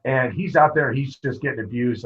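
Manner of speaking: usual speaking voice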